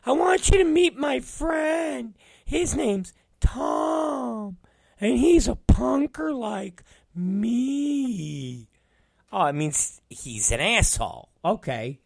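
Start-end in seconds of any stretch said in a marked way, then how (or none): 0.0-8.7 mocking high falsetto with stretched-out words; Will. Coworker introducing his punker friend, high mocking falsetto. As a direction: imitate him in a drawn-out squeaky whine. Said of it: "Will's the one that was like".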